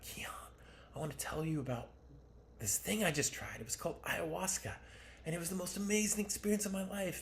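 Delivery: playful impression of an enthusiastic mother; imitating mom. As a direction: lift it up into mom's gushing tone. Mom voiced telling about ayahuasca.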